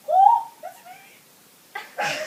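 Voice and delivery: very high, drawn-out squeal; loud, hammed-up astonished coo; startled discovery. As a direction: squeal it slowly in mock amazement. Acts out startled discovery of hair.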